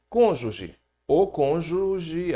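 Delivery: slow stressed o in "o cônjuge". Shown as a demonstration. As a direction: drag out the article o in "o cônjuge". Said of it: "A cônjuge não existe".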